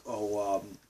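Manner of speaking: imitated northern English accent. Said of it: "when some of the northerners talk".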